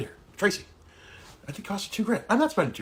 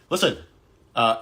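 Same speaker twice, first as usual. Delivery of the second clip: usual speaking voice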